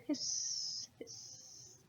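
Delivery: whispered hiss, hiss dying to nothing; fading whispered hiss. Acted. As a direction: let it fade out. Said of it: "the tea kettle whistled".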